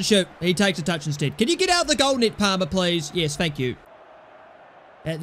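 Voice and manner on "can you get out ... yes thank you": ordinary talk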